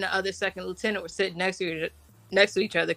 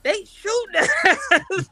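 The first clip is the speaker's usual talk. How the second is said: panicked; 12 semitones higher, 7 dB louder than usual. Retold or imitated. Panicked retold first mortar attack.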